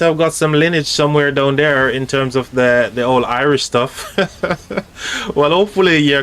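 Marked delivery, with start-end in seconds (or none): 4.2-5.3 chuckle. Real, not acted. Laughs about old Irish lineage.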